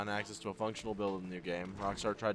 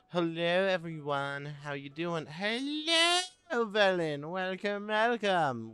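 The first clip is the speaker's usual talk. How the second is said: high lilting hello, welcome welcome; sing-song greeting; 7 semitones higher, much slower than usual. Real, not acted. Streamer welcoming chat to the stream, high sing-song greeting. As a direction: greet them like a cheery host.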